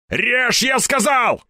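high-pitched commanding yell; insistent shout. A narrator's reading. Степаныч repeats his order to cut, shouting insistently. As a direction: overrule him with a yell.

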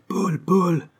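high calling cry of a woman. A narrator's reading. Woman calling Paul from behind them, high sharp call. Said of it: "cria une voix de femme".

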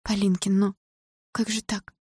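high, soft, faltering question; frightened dismay. A narrator's reading. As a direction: ask helplessly, taken aback. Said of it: "В её глазах появился испуг". (растерянно и испуганно)

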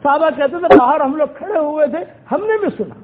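ordinary delivery

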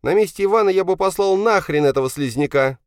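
high, loud indignant son. Acted. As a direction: sound off hotly, pitched far up. Indignant son argues with father. (возмущённо, высоким громким голосом)

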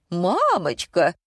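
slow, drawn-out exclamation of fright; acted shock, drawn out; startled cry. A narrator's reading. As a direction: stretch out a mild alarmed cry. Startled customer sees boy in tank.

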